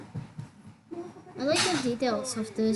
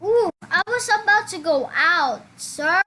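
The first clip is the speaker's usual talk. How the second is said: loud, raised-pitch exclamation; startled outburst; 5 semitones higher, 7 dB louder than usual. Real, not acted. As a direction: call out loudly to the other player. Startled while addressing another player.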